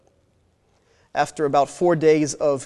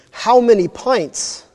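higher, louder, slow demand for an answer; interrogating demand; 7 semitones higher, 5 dB louder, slower than usual. Acted. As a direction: press him hard, each word slow and pointed. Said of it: "interrogating him".

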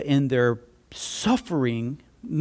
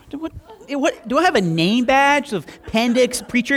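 higher, incredulous aside; mock disbelief; 9 semitones higher, 4 dB louder than usual. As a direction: act amazed he guessed it. Own incredulous reaction aside.

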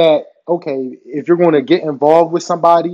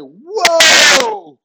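high, drawn-out alarmed whoa; comic panic, stretched out long; freaking out; 10 semitones higher, 4 dB louder than usual. Retold or imitated. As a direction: recoil in exaggerated shock. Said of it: "I'm freaking out in my head".

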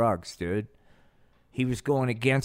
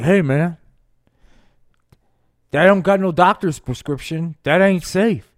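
loud, higher, put-on [name] voice; [name] warning; 5 semitones higher, 8 dB louder than usual. [name] scolding Nixon about weed, loud put-on warning. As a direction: warn him like a worried [name]. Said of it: "[name] is like".

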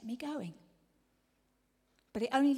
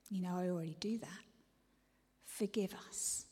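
soft, hushed praying; whispered prayer; 3 semitones lower, 4 dB quieter than usual. Acted. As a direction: pray it under your breath. Whispered confession.

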